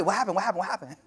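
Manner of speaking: rushed, repeated questions, imitating someone impatient; mock hasty questioning. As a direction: fire it off as if pressing for answers at once. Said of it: "didn't just right away".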